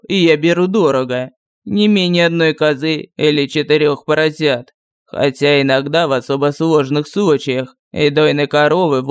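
lightly voiced village sorcerer; gruff sorcerer voice. A narrator's reading. Sorcerer voice held. (ворчливый голос колдуна)

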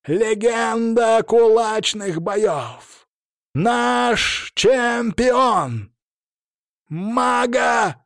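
very slow, drawn-out, high booming call; arena announcer. Acted. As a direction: stretch every word like a ring announcer. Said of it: "мощным голосом взревел ринг-анонсер".

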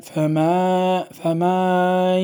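فما with the vowel drawn out very slowly; stretched letter error. Shown as a demonstration. Teacher showing how a letter gets dragged out, very slow. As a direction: stretch فما well past its length. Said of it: "يمطط الحرف".

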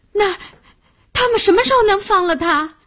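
high, loud, rushed question. A narrator's reading. Lin Xing grips the departing taxi asking about release, loud and urgent. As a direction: call out urgently before the car pulls away. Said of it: "高声地问道".

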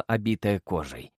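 ordinary delivery, nothing marked

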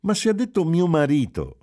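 high and loud, astonished; surprised exclamation. A narrator's reading. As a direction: cry it out in disbelief. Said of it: "esclamò Giulia sorpresa". (esclamazione sorpresa, alta e forte)

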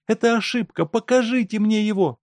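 high, loud agitated wife voice; wife insisting. A narrator's reading. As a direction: demand to see her husband. Wife argues with director.

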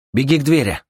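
high, loud order; commanding shout. Acted. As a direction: order her to run. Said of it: "приказал Син девушке".

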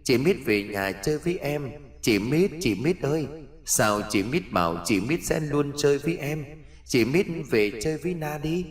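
put-on high, soft, echoing little-girl voice; ghost child calling. Ghost child calls Mít out to play, softly wheedling. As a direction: quietly lure a playmate outside. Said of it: "cất giọng gọi".

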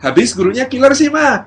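high, loud excuse to mum; blaming schoolchild. Acted. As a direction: play a kid shifting the blame. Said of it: "belajar untuk menyalahkan orang lain". (anak menyalahkan guru, nada tinggi dan keras)

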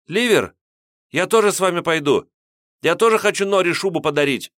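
put-on high, loud young boy; ringing boy's voice. A narrator's reading. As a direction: call out eagerly as a boy. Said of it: "звонкий голос мальчишки".

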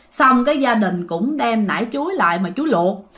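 ordinary talk

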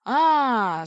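sudden loud outcry; mock bellow. A narrator's reading. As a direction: bellow it out, stretching the vowel. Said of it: "завопил вдруг толстый Пи".

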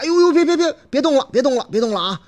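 high, loud frantic calls to stop; 急忙喊停. Acted. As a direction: shout her down before she pulls harder. 司机急忙喊停. (司机高声急喊别动)

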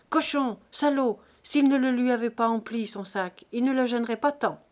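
loud, furious name-calling; a wife in pain cursing her husband, angry; hurling insults. Acted. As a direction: spit the insults back at him. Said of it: "Elle répondit par des injures".

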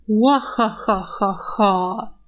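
slow, drawn-out mocking laugh; slow mocking cackle. Rat laughing at her.

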